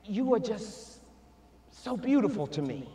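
soft, low loving words; softly adoring, dropping low; tender. Retold or imitated. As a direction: murmur it like a parent at the door. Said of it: "that person added".

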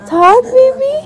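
very high, loud cooing. Real, not acted. Parent wanting baby to talk, loud high coaxing.

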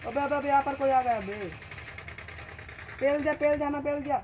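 loud, high alarmed yelling; excited shout. Real, not acted. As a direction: yell in panic as you're hit. Enemy arrives, shouting. (घबराकर ज़ोर से चिल्लाना)

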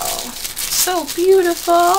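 slow, high, breathed-out admiration; awed gush. Real, not acted. Fan admiring her new figure, slow awed gush. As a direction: marvel at it slowly, voice lifted.

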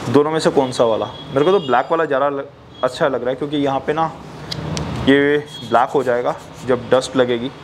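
muffled behind mouth guard; speaking under goggle mask; through a face mask. Real, not acted. Seen 0: Moto goggles with attached mouth guard cover mouth throughout the words.

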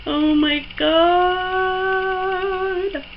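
very drawn-out, high wail of delight; giddy, long-held cry of joy; overwhelmed squeal. Real, not acted. Overwhelmed by the lip gloss.

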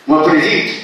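ordinary talk, nothing marked

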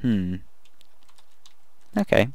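ordinary talk, nothing marked

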